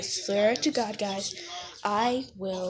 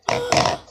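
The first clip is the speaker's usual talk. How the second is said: sharp surprised gasp; a sudden gasp of surprise; 5 dB louder than usual. Real, not acted. Sees how clear slime looks.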